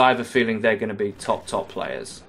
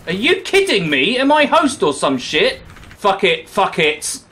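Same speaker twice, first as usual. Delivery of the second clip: frustrated outburst; 6 semitones higher, 3 dB louder than usual. Real, not acted. Frustrated gamer getting killed.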